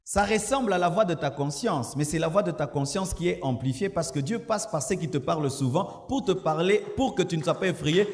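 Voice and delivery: normal talking voice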